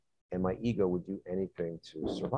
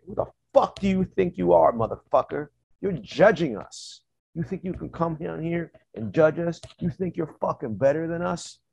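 higher, confrontational accusing voice; aggressive challenge; 8 semitones higher, 4 dB louder than usual. Retold or imitated. Attackers accusing him of judging them, aggressive. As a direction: get in their face, judging the judge. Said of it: "it was aggressive energy".

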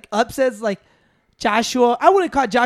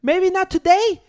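imitating Moreno; 8 semitones higher, 4 dB louder, slower than usual. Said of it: "He's like".

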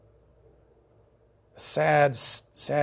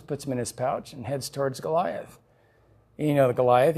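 ordinary talk

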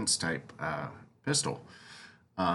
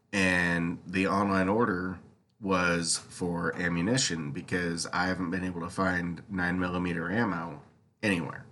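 ordinary talk

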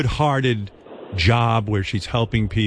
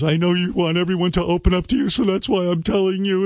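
higher, sweet-voiced imitation of the girl; mock girly voice; 7 semitones higher than usual. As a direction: mimic a contestant confiding sweetly. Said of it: "And she goes".

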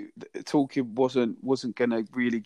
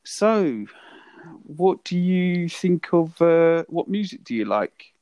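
talking to a foreigner; 6 semitones higher, 4 dB louder, slower than usual. Retold or imitated. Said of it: "So the whole date was".

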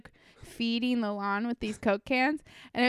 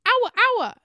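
high, loud yappy dog imitation; high-pitched mock barking words; little dog bark; 12 semitones higher, 8 dB louder than usual. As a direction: yap it like a tiny dog. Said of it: "it barks at it, but it says".

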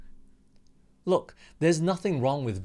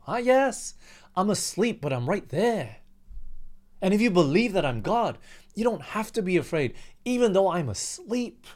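lightly raised voice of Jesus calming his disciples; voicing Jesus; 6 semitones higher than usual. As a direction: speak as Jesus, gently correcting from the boat. Preacher voices Jesus replying.

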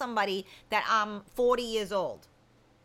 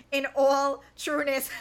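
laughing while reading; 2 semitones higher, 5 dB louder than usual. Real, not acted. Reading a friend's teasing text.

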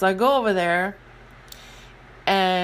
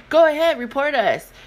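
put-on taunting kids; 8 semitones higher, 4 dB louder than usual.

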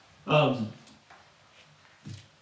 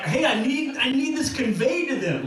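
higher-pitched urgent plea; insistent Paul; 6 semitones higher than usual. Acted. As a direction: press the point as an exasperated letter writer. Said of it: "like, Paul's like".

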